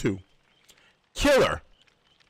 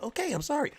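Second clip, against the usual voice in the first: higher, startled apology; mock yelped apology; 9 semitones higher, 6 dB quieter, faster than usual. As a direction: squeak out a hurried sorry like the scolded viewer. Viewer voice yelps apology.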